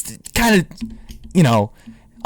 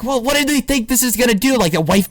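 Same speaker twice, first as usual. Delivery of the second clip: imitating Bill Burr; 6 semitones higher than usual. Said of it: "Bill was like".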